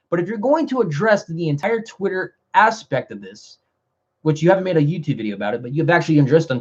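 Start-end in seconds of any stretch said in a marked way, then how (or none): none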